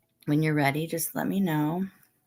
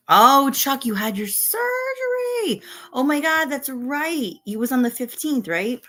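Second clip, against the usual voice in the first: much higher, startled sympathy; sudden surprised realization; 8 semitones higher, 3 dB louder than usual. Real, not acted. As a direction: gasp as it comes back to you. Suddenly remembers friend's surgery.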